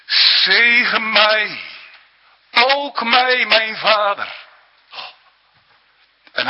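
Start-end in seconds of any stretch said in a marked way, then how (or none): 0.1-4.4 loud, high anguished plea, slow on the first words; pleading shout for a blessing; bitter cry. Acted. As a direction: cry out as Esau begging his father. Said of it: "Die bittere grote schreeuw".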